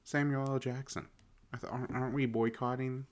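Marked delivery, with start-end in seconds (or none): none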